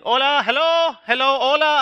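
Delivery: high calls of hello; frustrated calling. Retold or imitated. Learner calling hello into the drive-thru speaker. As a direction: call out for attention, frustrated. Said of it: "I'm just getting frustrated".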